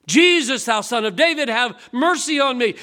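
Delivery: loud pleading cry to Jesus. Retold or imitated. Blind Bartimaeus begging Jesus, loud pleading cry. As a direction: beg loudly for mercy. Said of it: "He began to cry out".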